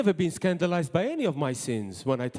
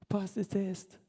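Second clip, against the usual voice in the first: quiet voiced "Pass the test", softer than usual; soft plain repeat; 7 dB quieter than usual. Shown as a demonstration. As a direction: say "Pass the test" again gently, aloud. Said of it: "I cannot emphasize this enough".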